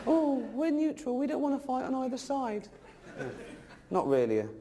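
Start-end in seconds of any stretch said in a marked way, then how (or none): 0.1-2.7 mock Swiss voice. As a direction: sneer it out as a timid Swiss. Mocking the Swiss as neutral.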